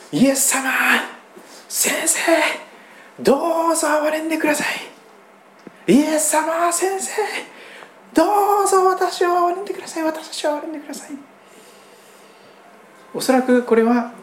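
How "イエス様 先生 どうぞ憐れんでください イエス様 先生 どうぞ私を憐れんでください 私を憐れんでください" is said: high, pleading cry from far off; 声を張り上げる叫び. Acted. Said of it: "遠く離れたところに立って声を張り上げました". (遠くから声を張り上げて憐れみを乞う)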